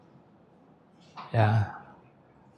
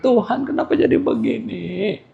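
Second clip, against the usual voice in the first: high, softer imitation of crying to God; mock tearful prayer; 7 semitones higher, 5 dB quieter than usual. Preacher re-enacting jobless members' prayer, mock crying. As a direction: whimper the plea, half in jest.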